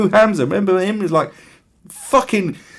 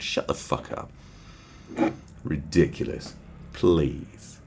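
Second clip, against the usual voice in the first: muttered aside; 7 semitones lower, 9 dB quieter, slower than usual. Real, not acted. Dismissive aside to himself.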